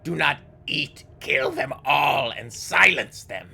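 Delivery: high screaming fury; raging ghoul. Acted. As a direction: scream it in wild rage. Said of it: "shattering the tiles in her rage".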